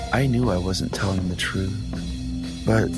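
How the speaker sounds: normal talking voice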